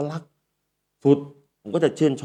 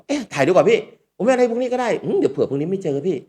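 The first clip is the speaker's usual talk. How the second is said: eager fan voice; 8 semitones higher than usual. Retold or imitated. A fan begging for a photo.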